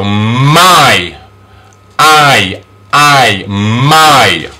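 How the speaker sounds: drawn-out I inside my; Y pronounced I. Shown as a demonstration. Teacher shows letter Y's sound, long I in my. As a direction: stretch I alone and in my. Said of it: "is pronounced I".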